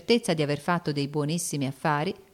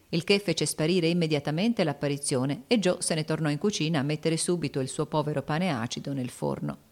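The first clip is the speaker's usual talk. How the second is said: plain reading voice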